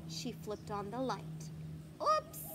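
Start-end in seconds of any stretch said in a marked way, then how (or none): 2.0-2.4 high, loud little cry; cried out. Acted. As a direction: squeak it like a caught child. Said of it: "cried Froggy".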